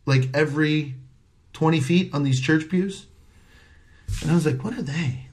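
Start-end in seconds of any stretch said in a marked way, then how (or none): 4.6-5.3 soft, puzzled aside; inner voice. Retold or imitated. Comic eyeing an unknown group from stage, inner voice. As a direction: think it aloud under the voice. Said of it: "Like, in my head".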